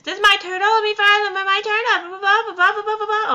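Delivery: put-on very high, loud crowd of kids; mock yelling. Many children yelling for their turn, very high and loud. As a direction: yell over everyone.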